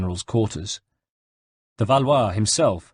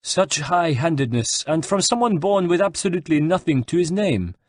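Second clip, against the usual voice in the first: higher-pitched aristocratic scorn; haughty disdain; 8 semitones higher than usual. A narrator's reading. De Valois belittles the captive general, haughty. De Valois sneers at Bartolomeo's birth.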